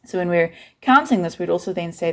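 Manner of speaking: ordinary talk, nothing marked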